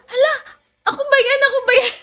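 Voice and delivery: high, excited disbelief; retold surprise. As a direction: squeal it like you can't believe your luck. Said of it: "sabi ko". (gulat na gulat, mataas ang boses)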